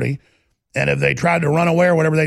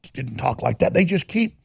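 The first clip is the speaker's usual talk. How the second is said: usual speaking voice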